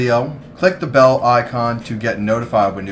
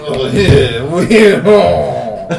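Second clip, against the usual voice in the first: hammed-up, loud French Canadian accent; high, exaggerated French Canadian honking; French Canadian snob; 4 semitones higher, 9 dB louder, much slower than usual. Mocking a French Canadian guy for a friend, hammed-up accent. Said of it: "French Canadian asshole".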